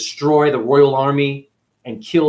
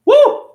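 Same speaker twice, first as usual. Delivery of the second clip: high, loud whoop; 9 dB louder than usual. Real, not acted. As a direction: let out a big excited whoop. Comedian whoops after the story.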